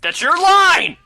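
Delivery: loud high shout; yelling. Real, not acted. Friend yells that it was his cue, loud shout. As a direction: shout it across the room.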